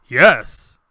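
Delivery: loud, low, deliberate actor's delivery; big, low voiceover read; voice actor's take. Retold or imitated. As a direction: deliver the one line with full weight. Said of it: "his line is "yes".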